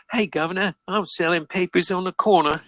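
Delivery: high-pitched Cockney-style paperboy; mock newsboy. Joking about working at fourteen, hammed-up high newsboy voice. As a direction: play a street urchin hawking papers, voice way up.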